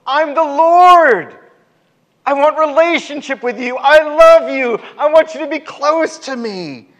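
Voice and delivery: high, loud, fervent cry; God, passionate. Acted. God declaring love to the people, passionate high cry. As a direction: proclaim love at full preaching force.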